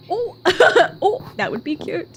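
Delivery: ordinary delivery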